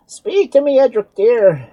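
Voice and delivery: very high, loud pleading cry; desperate cry. A narrator's reading. Doctor pleading with silent Edric, desperate. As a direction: beg him to answer. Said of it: "cried the poor doctor".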